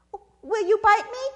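high, loud hesitant question; wary Sister Possum. Acted. Possum to pleading snake, high wary question. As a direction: ask doubtfully in a high voice.

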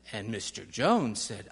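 usual speaking voice

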